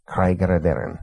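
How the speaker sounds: "Craig Radaran" flowing as one name; "Craig" like "cryg" with a long i, then "ra-DAR-an"; Welsh pronunciation. Shown as a demonstration. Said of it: "looks like Craig Y R".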